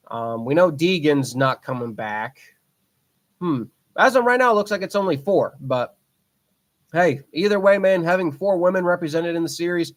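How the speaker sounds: ordinary talk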